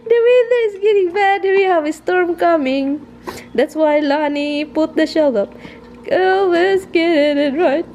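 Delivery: high, laughing through the words; giggling while mocking. Laughing while imitating husband.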